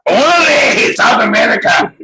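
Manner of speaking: loud, high yell of órale with a laugh; laughing Chicano shout. Acted. Shouted punchline in character.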